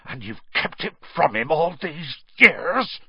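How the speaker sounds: fury building from restrained to loud and high; high, raging accusation; trembling with rage. A narrator's reading. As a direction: accuse him in mounting outrage. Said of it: "every syllable trembled with rage".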